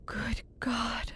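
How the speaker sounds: hushed, breath-only gasp of shock; horrified whisper. Acted. Visitor seeing the commander's face, horrified whisper. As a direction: barely breathe the words.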